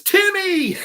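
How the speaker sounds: a big, happy hello at the door; excited greeting. Retold or imitated. Cuban associate greeting Timmy at his door, excited high call. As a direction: call the name out high and loud.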